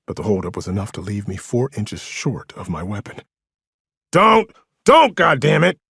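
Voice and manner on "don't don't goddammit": desperate high, loud shout; yelling. A narrator's reading. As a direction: yell to stop someone. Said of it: "Diggs yelled".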